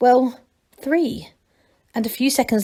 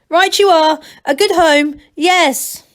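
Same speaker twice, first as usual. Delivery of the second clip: higher, louder soothing call down; calling reassurance; 8 semitones higher, 7 dB louder, slower than usual. A narrator's reading. Masklin calling down to reassure Angelo, louder. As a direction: humour him from above.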